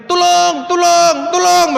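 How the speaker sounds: high, drawn-out comic yell; calling for help. Acted. Man turns from God to other rescuers, acted calling for help. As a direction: holler for help, loud and stretched out.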